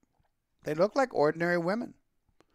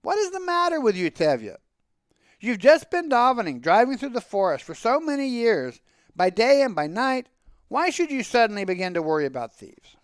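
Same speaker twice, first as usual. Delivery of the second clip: high, exasperated questioning of himself; raised, incredulous self-reproach; self-scolding; 7 semitones higher than usual. A narrator's reading. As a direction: scold yourself for being silly. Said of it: "I correct myself at once".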